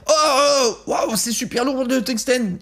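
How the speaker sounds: hammed-up wow at the weight; fake amazement. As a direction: overact being amazed by the heavy cube. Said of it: "je tiens le tungstène et je fais".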